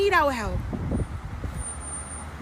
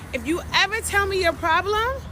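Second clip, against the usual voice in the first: ordinary talk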